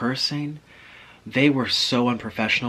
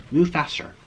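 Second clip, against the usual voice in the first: usual speaking voice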